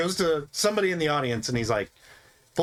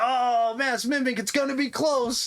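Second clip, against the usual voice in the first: mock show host; 10 semitones higher, slower than usual.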